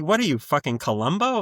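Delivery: ordinary delivery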